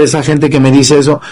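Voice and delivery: usual speaking voice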